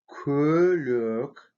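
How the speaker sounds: Көлөк with fronted, rounded ö in both syllables; soft rounded Ө. Shown as a demonstration. Teacher showing vowel harmony, Көлөк with two rounded ö sounds. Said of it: "и он произносится как".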